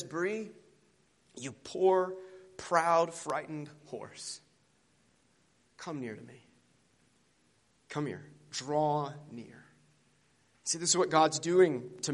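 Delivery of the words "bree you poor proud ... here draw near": gentle Aslan. Acted. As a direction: call the frightened horse near, tenderly. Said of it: "And he says".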